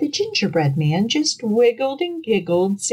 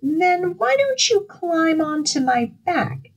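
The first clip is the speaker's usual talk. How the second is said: sly fox; 10 semitones higher than usual. Acted. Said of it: "asked the fox".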